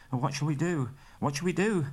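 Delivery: rising alarm, asking twice; frightened cry. A narrator's reading. Bilbo panicking at the wolves' howl, frightened. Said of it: "Bilbo cried".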